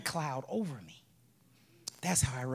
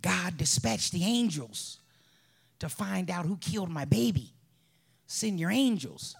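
mother praying; 4 semitones higher, 5 dB louder than usual. Retold or imitated. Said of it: "I'm hearing my mother in her room praying".

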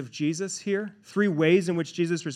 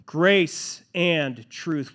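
slow emphatic; much slower than usual. Real, not acted. Sermon point restated slowly.

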